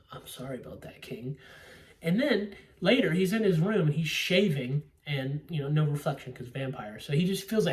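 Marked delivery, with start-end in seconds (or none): 0.1-1.4 soft, low, submissive imitation; mock meek apology. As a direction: apologize low and small, as to a ruler. Mocking Keanu's meek apology to Dracula.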